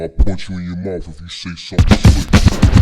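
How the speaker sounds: shouted, menacing warning; loud threat. Real, not acted. Rapper shouting a threat at rivals, loud and menacing. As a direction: bark the threat.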